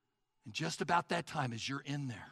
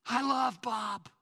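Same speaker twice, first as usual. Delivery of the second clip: proclaimed; 5 semitones higher, 5 dB louder, slower than usual. Acted. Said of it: "it says".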